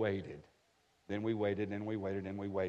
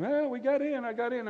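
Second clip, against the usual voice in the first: daughter's high, thrilled cry; excited daughter; 12 semitones higher, 4 dB louder than usual. Retold or imitated. Daughter bursting in to tell dad, excited. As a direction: squeal the good news much higher. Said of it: "when she came".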